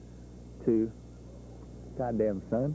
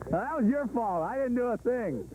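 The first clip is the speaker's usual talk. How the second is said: higher, playful accusation; mock protest; 9 semitones higher than usual. Real, not acted. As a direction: tease him with fake innocence. Blaming cameraman, giggly.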